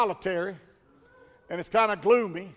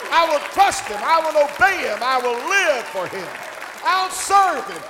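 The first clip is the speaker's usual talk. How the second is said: starts near plain and builds higher and louder to a shout; building preaching; 3 semitones higher, 4 dB louder than usual. Acted. Preacher declaring he will rejoice, building preaching. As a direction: climb steadily into full preaching fire.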